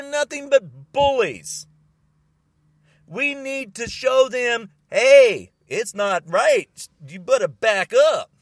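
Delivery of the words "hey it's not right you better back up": loud, slow-punched confrontation; forceful warning. Acted. Standing up to Trumper bullies, forceful warning. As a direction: shout them down firmly.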